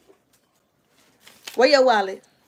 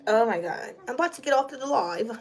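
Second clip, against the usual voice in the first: usual speaking voice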